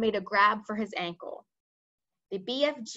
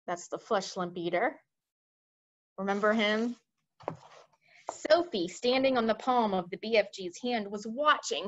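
ordinary delivery

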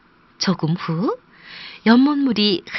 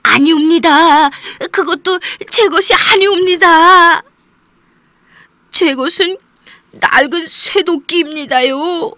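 high, loud, near-tearful humble denial; honest woodcutter almost crying in denial; pleading woodcutter; 8 semitones higher, 8 dB louder than usual. Acted. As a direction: beg him to understand it's not yours. Woodcutter refuses again.

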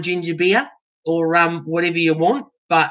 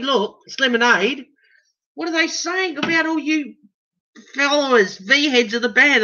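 pitched way up, mock outrage; high indignant disbelief; 7 semitones higher than usual. Real, not acted. Protesting the misprint to his followers, high mock outrage.

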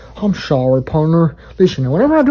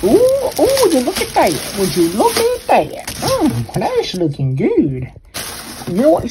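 ordinary talk

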